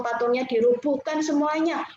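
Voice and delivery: plain reading voice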